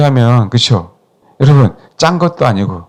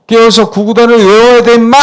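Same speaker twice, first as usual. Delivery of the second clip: higher, louder mock yell at a pupil; mock shout; 8 semitones higher, 5 dB louder than usual. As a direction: yell it like a stern teacher. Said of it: "라고 소리칠래". (구구단 외우라고 호통치듯)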